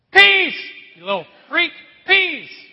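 joke: promise quiet, then shout it; ironic very quiet voice. Retold or imitated. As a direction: parent snapping at backtalking kids, mock shout. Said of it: "say in a very quiet voice".

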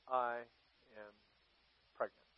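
very soft, one word at a time; hushed, halting reveal; grave three-word message. Acted. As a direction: drop low and leave a pause after each word. Reading her three-word letter.

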